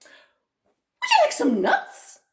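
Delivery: very high, loud, slow monkey voice; squeaky monkey. Acted. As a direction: offer it as a squeaky little monkey. Monkey offers nuts.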